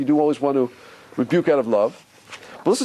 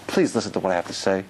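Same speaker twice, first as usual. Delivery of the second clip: gentle, loving voice; 7 semitones lower than usual. Acted. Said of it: "So God has to speak gently".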